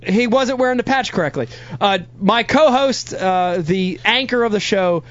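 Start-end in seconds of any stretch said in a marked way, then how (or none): none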